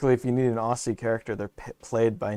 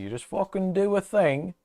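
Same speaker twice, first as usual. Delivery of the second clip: higher-pitched mock English accent; 9 semitones higher than usual. Shown as a demonstration. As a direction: toss off an easy English accent. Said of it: "English accent, easy".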